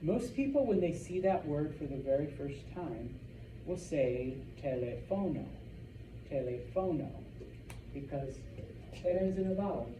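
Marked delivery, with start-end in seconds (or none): 4.6-7.1 "teléfono" stressed as te-le-FO-no; wrong second-to-last stress. Shown as a demonstration. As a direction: put the weight on FO in "teléfono". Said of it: "the stress should be right here".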